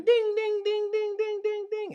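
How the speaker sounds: high, loud sing-song ding of a crossing bell; ping each ding up high like the warning bell; railroad bell imitation. Mimicking the crossing bell for the audience, high loud dings. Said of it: "the crossbuck is just dropping".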